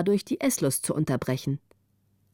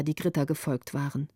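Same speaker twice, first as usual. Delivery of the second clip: plain reading voice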